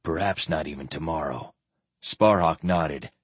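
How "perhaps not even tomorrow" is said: soft and low, the squire speaking; quiet. A narrator's reading. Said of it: "the squire said quietly".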